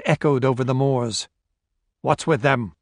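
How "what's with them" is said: ordinary delivery, nothing marked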